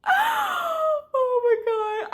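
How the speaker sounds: high, drawn-out squeal of delight; squealing oh my God. Real, not acted. Gushing over a romantic scene.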